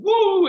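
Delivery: loud, hammed-up whoop; faked enthusiastic woo; high mock "woo". Mimics his own phony enthusiasm.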